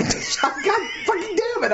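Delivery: high, slow, laughing exasperated outburst; cursing at yourself while cracking up; laughing curse. Real, not acted. Reader fumbling the quote again, laughing curse.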